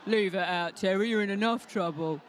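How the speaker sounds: gruff deep Cockney telling his mate off; deep Cockney geezer. Pretend. Second sparrow telling Terry off, deep Cockney. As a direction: talk down low like a London tough. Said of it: "don't have deep voices like that".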